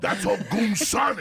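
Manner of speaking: slow, loud, high hype call; hype shout. Retold or imitated. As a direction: shout it to fire them up. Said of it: "they go fucking nuts".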